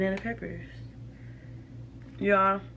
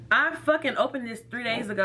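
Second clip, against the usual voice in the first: normal talking voice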